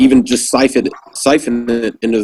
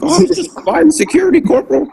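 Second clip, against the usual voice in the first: much higher, silly put-on voice of a caught Marine; mock sleepy excuse. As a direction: squeak out a lame excuse like a busted private.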